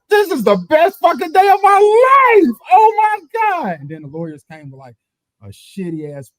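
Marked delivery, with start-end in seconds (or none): 0.1-3.8 overjoyed. Retold or imitated. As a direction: lose it with joy. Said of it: "I was like".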